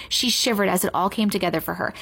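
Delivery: ordinary delivery, nothing marked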